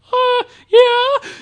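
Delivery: high, strained nervous yes; a frightened kid squeaking out an answer; scared squeak. Retold or imitated. Caught kid answers the guard, scared squeak.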